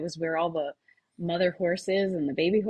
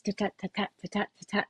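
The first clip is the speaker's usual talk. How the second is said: softer, fast even syllables; quick quiet rhythm chant; 6 dB quieter than usual. Shown as a demonstration. Showing the podcast host the running rhythm, quick syllables. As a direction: tap out a quick running beat. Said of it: "like that".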